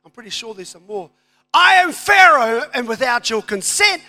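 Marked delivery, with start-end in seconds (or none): 1.5-4.0 booming Pharaoh. Acted. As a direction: declare it like a king on his throne. Reading Pharaoh's decree aloud.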